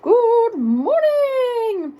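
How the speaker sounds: very high, slow trumpeted greeting; trumpeting elephant. A narrator's reading. Elephant greeting the brave giraffe, trumpeting. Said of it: "The elephants would trumpet".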